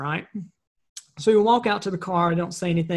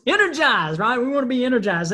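higher, energetic repeat; energized; 6 semitones higher, 3 dB louder than usual. Real, not acted. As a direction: punch ENERGIZED with rising excitement. Repeats the point with more energy.

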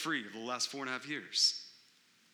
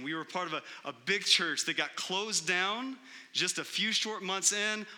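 ordinary delivery

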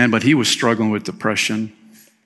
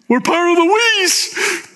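playful high voice. Acted. Playful emphasis on we's.

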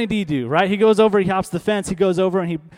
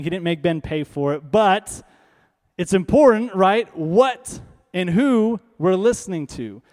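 ordinary delivery

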